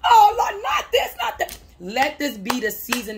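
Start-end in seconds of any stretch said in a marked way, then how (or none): 0.0-1.6 mock cry of dismay. Imitating doubters crying out, high then fading. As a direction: wail it like the doubters groaning. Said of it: "They tried to stop you".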